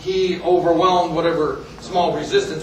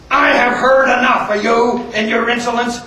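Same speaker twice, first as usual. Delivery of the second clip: acted, louder stern outrage; raised angry officer voice; furious colonel; 4 semitones higher, 5 dB louder than usual. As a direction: lay down the law as a fed-up colonel. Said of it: "colonel's face turns red as a, he goes nuts".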